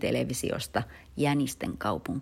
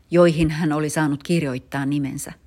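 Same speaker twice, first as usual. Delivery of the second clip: ordinary delivery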